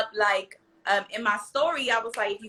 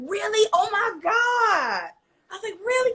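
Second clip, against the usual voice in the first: high, squealing surprise; surprised; 11 semitones higher than usual. Retold or imitated. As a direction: gasp out in disbelief. Retelling surprised reaction.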